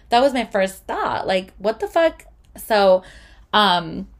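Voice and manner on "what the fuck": high-pitched retold disbelief; incredulous outburst. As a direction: jump up in pitch, indignant and baffled. Retold first reaction to refund demand.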